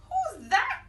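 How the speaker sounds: high, loud startled cry; Ben cries out. Acted. Ben cries out at the knock, high and loud. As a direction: call out in surprise.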